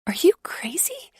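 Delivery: high, breathy hushed shout; whisper shout. A narrator's reading. Wife to husband, child nearby: whispered shout. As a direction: shout it under your breath. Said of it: "I whisper shout".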